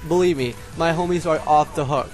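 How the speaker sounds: ordinary talk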